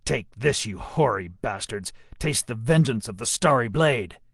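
gruff warrior roaring defiance; snarling battle cry. A narrator's reading. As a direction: snarl it as you open fire. Merak attacks with a snarl.

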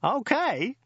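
high, incredulous cry; shouted. A narrator's reading. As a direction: throw the word back in high-pitched outrage. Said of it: "shouted Joe".

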